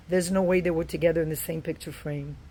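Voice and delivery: normal talking voice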